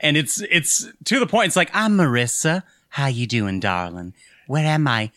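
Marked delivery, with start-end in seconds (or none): 1.7-5.1 lightly hammed-up southern accent, sweet-talking; mock southern belle cooing darling and honey; extra southern accent. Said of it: "Extra southern".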